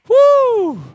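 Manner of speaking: high, loud preacher whoop; excited whoop. Real, not acted. Pastor whooping at his own point, loud and high. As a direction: let out a joyful woo.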